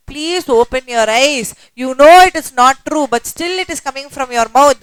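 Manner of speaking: loud, emphatic mock plea; insistent public appeal. Horlicks urging the public to wake up, loud and insistent. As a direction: urge them loudly, voicing an advert's message. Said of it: "Horlicks made a public service message".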